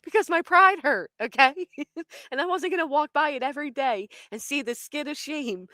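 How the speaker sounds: high voice full of laughter; laughing protest. Real, not acted. Storyteller defending her hurt pride, laughing protest. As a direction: defend yourself while cracking up. Explains sweeping, still laughing.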